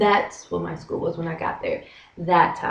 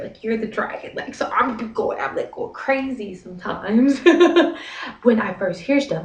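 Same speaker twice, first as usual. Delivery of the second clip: normal talking voice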